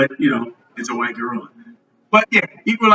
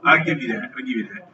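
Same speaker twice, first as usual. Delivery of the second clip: ordinary talk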